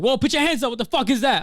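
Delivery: high, loud startled challenge; alarmed shout. Retold or imitated. Guy at the door reacting to the bag, alarmed high shout. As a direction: shout it like a guard spotting a threat.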